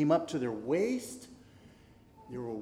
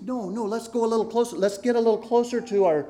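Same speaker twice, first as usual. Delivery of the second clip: quick, higher, urging voice; 5 semitones higher, 7 dB louder, faster than usual. Acted. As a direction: press forward eagerly.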